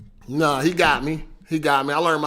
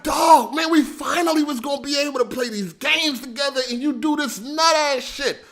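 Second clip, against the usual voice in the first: mad retelling; 8 semitones higher than usual. Retold or imitated. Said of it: "made me mad is that he".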